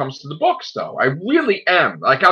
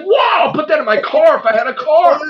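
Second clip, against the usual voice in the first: louder, higher, a pumped-up fan gushing; excited mock quote; 6 semitones higher, 3 dB louder than usual. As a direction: hype it like you can't wait to blast it. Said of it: "I was like".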